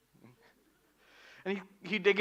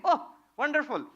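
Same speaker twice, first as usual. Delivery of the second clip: delighted; 12 semitones higher, 6 dB louder than usual. Retold or imitated. Friend rejoices.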